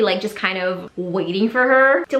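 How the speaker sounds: ordinary delivery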